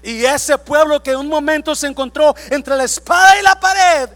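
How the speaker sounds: shouted high and loud at the peak; full-force preacher shout; preaching shout. Real, not acted. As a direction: cry it out to the congregation.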